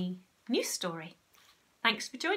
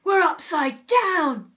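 loud, slow, high exclamation; aghast character outburst; shocked gasp; 8 semitones higher, 13 dB louder, slower than usual. A narrator's reading. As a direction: cry it out in drawn-out horror. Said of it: "gasped Mister Twit".

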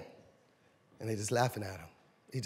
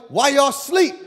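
loud, higher, fed-up rebuke; exasperated scolding; 5 semitones higher, 6 dB louder than usual. Acted. Jesus scolding his sleeping disciples, exasperated. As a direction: wake them up with your frustration.

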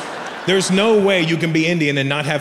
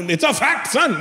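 put-on high Indian-accented assertion; dad's Indian accent, emphatic; insistent Indian dad; 9 semitones higher than usual. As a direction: imitate a stubborn Indian father. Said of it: "My dad goes".

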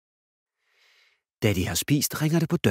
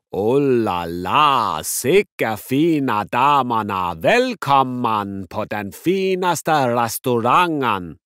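very slow, drawn-out, louder hammy waiter; Italian waiter; 4 semitones higher, 6 dB louder, much slower than usual. Acted. Dad as waiter welcoming the girls, hammy and slow. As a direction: savour every word like a showy waiter. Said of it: "den italienske tjener".

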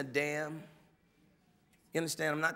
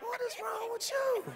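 high, startled mock outcry; the whole house recoiling at her; family's shocked reply; 3 dB quieter than usual. The household reacting to her outburst, a high startled outcry. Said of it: "everybody in the house is like".

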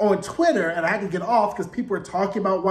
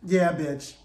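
lower, sassy drawl, mocking; 3 semitones lower, much slower than usual. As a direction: throw shade like a catty friend. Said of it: "the gays were like".

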